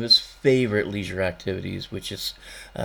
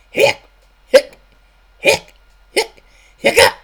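loud comic hiccups; 14 dB louder than usual. Acted.

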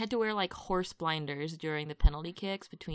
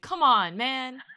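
exasperated; 6 semitones higher, 11 dB louder, slower than usual. Retold or imitated. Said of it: "and I was like".

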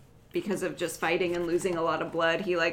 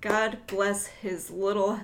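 usual speaking voice

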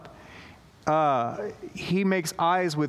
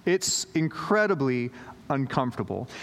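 normal talking voice

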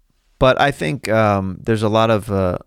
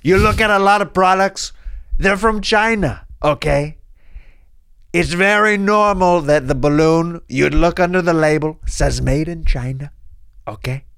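higher, emphatic presidential caricature; boastful president impression; 7 semitones higher than usual.

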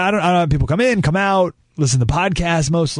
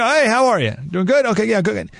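bright, higher, hurried hallway hello; quick pleasantries; 7 semitones higher than usual. Retold or imitated. Passing a coworker in the hall, breezy higher pleasantry. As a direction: toss it off like a passing greeting. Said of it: "Just pleasantries".

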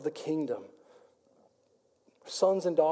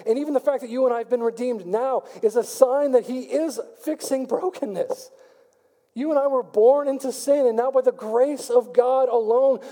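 higher-pitched full-force preaching, building; fired-up preaching; 4 semitones higher than usual. Acted. Pastor rallies the church, fired-up preaching. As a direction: preach it with rising fire. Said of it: "Man, I'm getting fired up".

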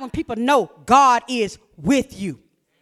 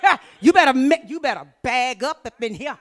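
ordinary talk, nothing marked

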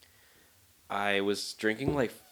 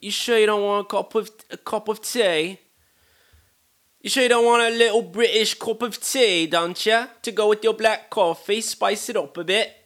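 very high, loud, hammed-up British voice; horrible British accent; 12 semitones higher, 10 dB louder than usual. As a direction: coax in an over-the-top fake British accent. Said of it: "sorry for this horrible accent".

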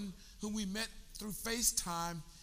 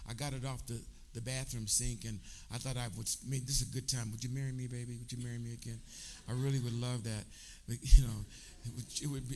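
tender proposal; 10 semitones lower than usual. Real, not acted. Husband proposing again to wife in church, tender and low. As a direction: propose to her gently, voice dropped low.

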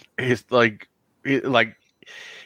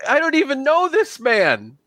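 tearful NPC; 11 semitones higher than usual. Acted. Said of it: "He goes".

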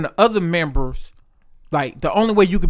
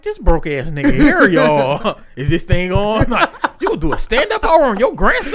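mock stand-up; 5 semitones higher, 5 dB louder than usual.

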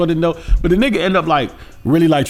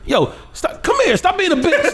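very high, laughing tough-guy imitation; mock tough; 13 semitones higher than usual. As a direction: play tough, half cracking up. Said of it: "he plays tough. Like, it's a joke".

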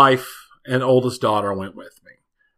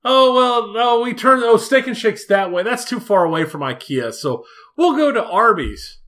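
high mock family voice; 9 semitones higher than usual. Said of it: "then they're like".

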